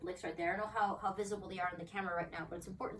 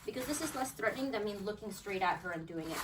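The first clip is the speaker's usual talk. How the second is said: ordinary talk, nothing marked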